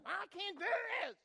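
high, whiny mock frustration; hammed-up exasperation; frustrated wife. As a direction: play the wife fed up with crochet.

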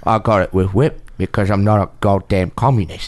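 a hint of Cockney, low and measured; lightly voiced English film star; Michael Caine voice. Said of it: "your Michael Caine".

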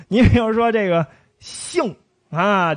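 ordinary delivery

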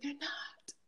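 a sudden soft whisper. Real, not acted. A speaker telling students life isn't separate, whispered. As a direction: drop to a whisper to drive it home. Emphatic point, dropped to whisper.

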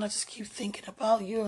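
breathy whisper of longing. Real, not acted. Whispering her longing into a voice note to sweetheart. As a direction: hush it out, aching for him. Lovesick voice message.

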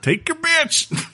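put-on very high, slow crazy old coot; old prospector. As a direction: squeak it out like a mad prospector. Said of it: "I'm playing as a crazy prospector".

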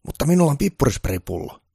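high-pitched, loud security guard. Acted. Guard telling Santa he has no gun licence, high and loud.

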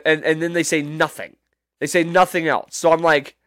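ordinary delivery

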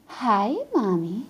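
put-on slow, sugary little-kid voice; sweet child. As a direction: coo it like an eerie sweet toddler. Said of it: "creepy cherubic look".

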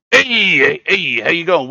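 loud, high, eager welcome; eager greeting. Acted. Welcoming leader greets arriving adventurers, eager and loud. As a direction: call out a big hearty welcome.

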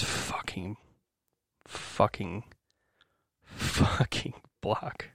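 quiet, halting curse, nearly whispered at the end; worn-down, low-volume exasperation; real frustration. Real, not acted. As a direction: force each repeat out slowly under your breath. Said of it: "I'm just frustrated".

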